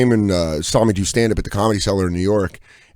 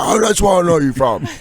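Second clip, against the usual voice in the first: higher-pitched relieved Londoner; London accent; 6 semitones higher than usual. Retold or imitated. London stranger placing the TV actor, London accent. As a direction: imitate a London man finally placing a face. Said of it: "I was in London".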